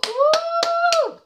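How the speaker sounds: high whooping cheer. Real, not acted. Host celebrating the end of the episode, high whoop. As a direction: let out a happy whoop.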